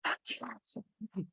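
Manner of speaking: soft, slow, drawn-out warning; little inner voice. Acted. Intuition quietly warning the listener, little inner voice. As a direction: play a small cautioning voice from within. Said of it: "that little voice within us".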